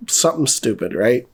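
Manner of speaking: ordinary delivery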